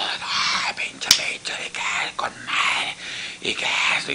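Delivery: whispered crowd awe; awed whisper. Retold or imitated. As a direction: breathe it out in amazement. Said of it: "toda la gente ovacionándolo". (susurro asombrado del público)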